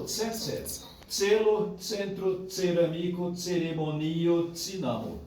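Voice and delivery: each C in "Tselo, centro, ceramico" said as ts. Shown as a demonstration. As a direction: model "ceremonio, tsinamo" with ts onsets. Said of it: "o C é sempre esse pronúncio".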